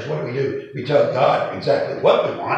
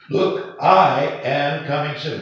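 I drawn out, louder and higher; stress on I; 4 semitones higher, 5 dB louder, slower than usual. Retold or imitated. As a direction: lean hard on the word I, slowly. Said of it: "Jesus didn't say".

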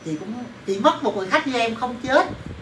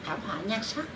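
muttered insult; 12 dB quieter than usual. Retold or imitated. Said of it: "Nói lại xem".

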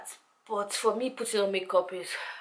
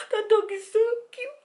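very high, hammed-up tearful squeal; mock crying. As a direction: wail it as if overcome by cuteness. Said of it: "And I'll start crying".

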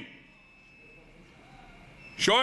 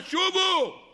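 high ringing call of return; 7 semitones higher, much slower than usual. Acted. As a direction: cry it out high. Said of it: "הבת קול אמרה".

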